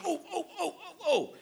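put-on high, soft "oh, oh" like a child bursting to answer; eager little girl. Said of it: "a little, uh, girl in Sunday school".

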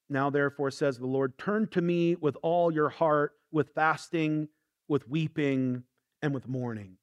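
low, soft reading of the verse; scripture reading. Acted. Pastor reading Joel to the congregation, solemn and soft.

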